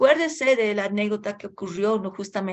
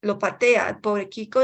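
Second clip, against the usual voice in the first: ordinary talk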